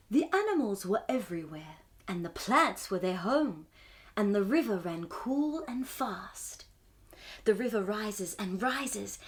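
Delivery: gentle storybook reading; story narration. A narrator's reading. Reads the picture book aloud.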